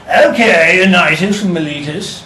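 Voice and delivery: a hint of a British accent for the ancient Greeks. Teacher voicing the Athenian magistrate in a British accent. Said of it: "the British weird accent we give to the Greeks".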